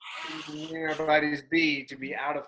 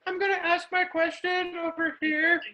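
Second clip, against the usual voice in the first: timid voice. Retold or imitated. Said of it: "be like".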